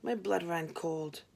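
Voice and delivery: ordinary delivery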